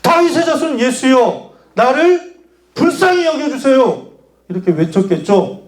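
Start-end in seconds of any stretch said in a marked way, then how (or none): 0.0-4.1 high, straining plea for mercy; all-out pleading cry. Acted. Blind man begs Jesus for mercy, crying out high. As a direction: call out with every bit of strength, pitched high. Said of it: "엄마 젖 먹던 힘까지 다 해가지고".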